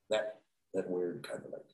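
ordinary delivery

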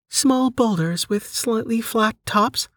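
high, slow, measured question; menu system voice, querying. Acted. Menu offering Delta an option, high slow questioning voice. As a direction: read it out slowly as a high-pitched query.

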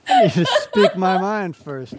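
high, loud and slow, through laughter; mock defiant outburst. Host teasing the guest as the stubborn patient, loud and laughing.

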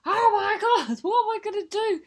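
high, loud imitated panic. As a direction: squeal it in a mock panic. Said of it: "He's having an absolute panic".

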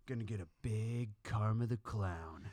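quiet, slow proud dad; low slow dad voice. Retold or imitated. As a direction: say it slow and deadpan. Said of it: "he goes".

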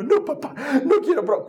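put-on high, laughing childish whine; child refusing. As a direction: whine it like a giggling kid. La hija se niega. (niña quejándose entre risas)

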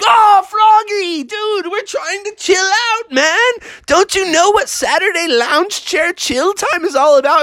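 high, exasperated whine; complaining animals. Acted. Neighbors beg Froggy to chill out, exasperated. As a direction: stretch the opening groan, then protest. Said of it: "all the other animals like".

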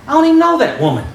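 high-pitched husband's protest; baffled husband. Acted. Husband answering his wife over dinner, high-pitched protest. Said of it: "and he says".

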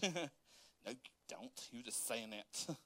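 low, quiet mock dismissal; imitated unconvinced wife. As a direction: drop the volume and brush off the sweet talk.

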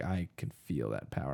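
low, quiet villain voice; low and soft, greedy villain; menacing villain. Retold or imitated. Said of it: "but he's like".

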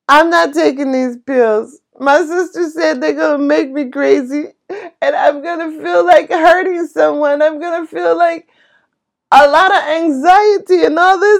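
high, tearful protest; half-crying refusal on the phone to her husband; retelling a tearful no. Retold or imitated. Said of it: "I am literally crying".

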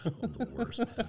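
high laugh at own mistake. Real, not acted.

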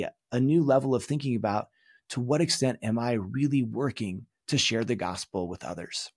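normal talking voice

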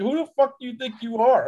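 high-pitched, mock-outraged comeback; indignant retort. Telling off a snobby woman in the bail room, indignant.

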